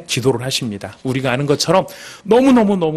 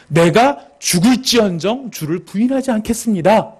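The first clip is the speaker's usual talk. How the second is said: slow, high-pitched declaration; resolute vow; 7 semitones higher than usual. Acted. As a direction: swear loyalty with full conviction. Peter's vow acted out. (비장하게 맹세하듯)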